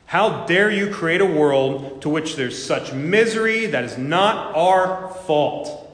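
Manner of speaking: a preacher acting out an atheist's furious tirade against God; outraged tirade. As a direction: hurl the accusation at God with mounting fury. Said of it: "He replied".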